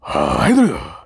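low, garbled male groan; porno guy groan. Acted. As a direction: let out a low mock porn groan. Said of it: "the guys in the porno".

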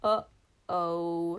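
a low worried giant. A narrator's reading. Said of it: "said Crusher".

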